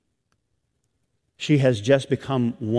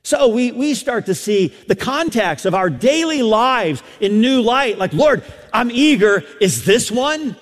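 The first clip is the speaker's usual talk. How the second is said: higher, louder pulpit delivery; full-force preaching; 11 semitones higher, 5 dB louder than usual. Real, not acted. Preacher urging the congregation to see daily life anew, impassioned preaching. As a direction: preach it out high and driving.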